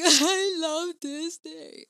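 high, loud, drawn-out fan squeal; hammed-up superfan outburst; over-the-top excitement. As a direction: gush it slowly at the top of your voice. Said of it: "I'm instead the more excited one".

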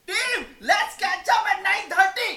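high, loud, slowed boss voice; hammed-up manager calling the team; mimicking the manager. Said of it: "My manager was like". (Manager की नकल, ऊँची तेज़ आवाज़ में)

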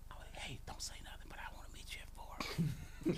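secretive mock-girlish whisper. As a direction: whisper it quickly, conspiratorially.

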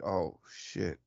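understated, quietly dawning regret; hushed realisation. Retold or imitated. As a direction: let it sink in softly. Retold moment of sudden realisation.

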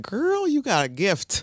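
mild mock approval; impressed demon boss. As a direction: drawl it like an admiring mentor. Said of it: "He's like".

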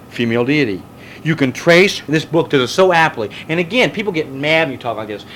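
normal talking voice

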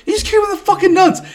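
very high, imitated indignant outburst; retold angry yell. Troy arguing with Farmer over Poops, mock angry yell. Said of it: "Troy yelled at him".

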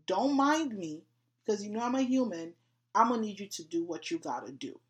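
high, loud, casual call to God; loud casual plea. Retold or imitated. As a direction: belt it out like talking to a buddy. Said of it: "And I specifically say".

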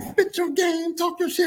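fawning followers. Retold or imitated. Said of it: "They be like".

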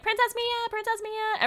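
high calling out; reporters calling out. Retold or imitated. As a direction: call her name like paparazzi. Press calling out to Mia.

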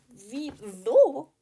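loud, high indignant yell; outraged shout. Pretend. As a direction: blurt it out in shock. (empörter Ausruf)

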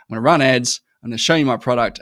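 loud, pushy salesman voice; mock sales pitch. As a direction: blare it like a pushy ad.

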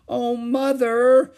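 high, loud, drawn-out cry; horrified wail. A narrator's reading. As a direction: wail slowly in dismay. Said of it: "repeated Beezus".

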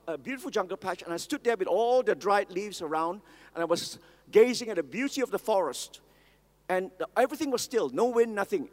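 normal talking voice

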